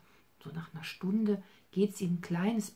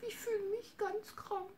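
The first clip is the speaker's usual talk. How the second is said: sad little bunny. Acted. Bunny Hops tells grandma he feels sick, high crying whimper. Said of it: "so ganz traurig und sagte".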